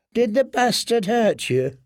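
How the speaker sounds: high, falsely gentle woman's voice; mock-pitying washerwoman. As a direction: pretends sympathy before the kill. Rowan feigns pity.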